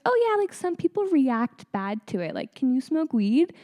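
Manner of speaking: high-pitched casual explaining; breezy hygienist. Retold or imitated. Hygienist explaining a bad reaction to the patient, breezy imitation.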